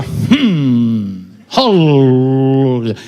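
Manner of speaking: deep, low, hollow otherworldly voice; voice from beyond the grave. Retold or imitated. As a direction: intone it like a ghostly oracle. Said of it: "el que te salga una voz de ultratumba". (voz de ultratumba)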